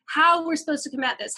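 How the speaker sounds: usual speaking voice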